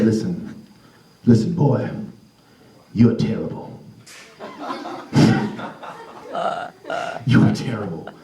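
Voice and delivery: low, gruff-free elder voice; old man telling hard truth gently; deep old man Withers. Acted.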